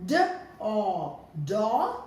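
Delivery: each sound said separately, then blended into do; sounding out letters. Shown as a demonstration. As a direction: sound out D, then O, then join them. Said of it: "the third sound is G".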